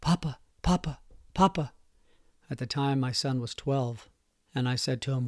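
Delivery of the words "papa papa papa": mildly higher, urgent calling of papa; panicked child. Retold or imitated. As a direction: relive the son's frightened call.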